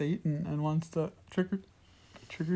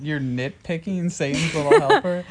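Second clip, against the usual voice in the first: laughing; 7 dB louder than usual. Real, not acted. Teasing the movie nitpick.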